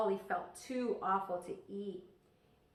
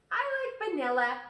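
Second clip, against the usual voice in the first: higher, louder, quick and bouncy; chirpy little sister; 8 semitones higher, 8 dB louder, faster than usual. Acted. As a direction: pipe up fast like an eager little girl. Little sister chirping her preference.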